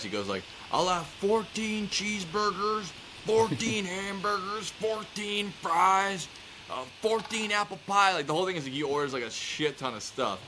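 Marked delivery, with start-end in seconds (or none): 0.7-8.1 high, drawn-out comic ordering; Chris Farley. Retold or imitated. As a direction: stretch each item out in a high voice. Chris Farley sketch order acted.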